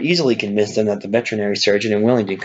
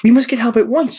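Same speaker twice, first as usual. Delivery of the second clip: urgent alarm; 5 dB louder than usual. Acted. Clover urgent over fallen Boxer.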